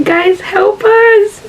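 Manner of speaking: high, drawn-out cry for help; desperate retold shout. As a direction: wail it out slowly, pitch way up, voice strained. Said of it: "and then I'm shouting".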